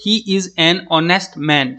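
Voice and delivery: slow, careful example sentence; slow teaching demo. Shown as a demonstration. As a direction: read the example out slowly for the class. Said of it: "अब देखो H जो है".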